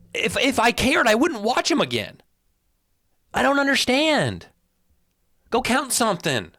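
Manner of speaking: high, incredulous complaint; exasperated rant. Real, not acted. Host telling off nitpicking viewers, exasperated. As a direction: push the pitch up, baffled and fed up.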